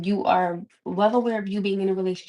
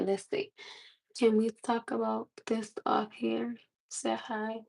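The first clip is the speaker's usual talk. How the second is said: normal talking voice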